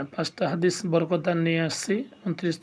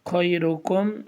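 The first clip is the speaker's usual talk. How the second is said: slow, drawn-out Quranic recitation; recitation reading; much slower than usual. Shown as a demonstration. Teacher reading the hadith to viewers in slow recitation. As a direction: recite it slowly and evenly. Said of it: "reading পড়ব".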